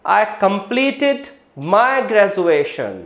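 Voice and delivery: very slow, careful model sentence; slow demo sentence. Shown as a demonstration. Teacher reading a slow example about graduation. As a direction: drag each word out for students. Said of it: "इसके जैसा एक और word".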